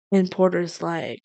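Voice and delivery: ordinary delivery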